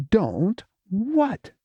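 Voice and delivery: high impatient question; prompting. Acted. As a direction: push her for the rest. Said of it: "he prompted".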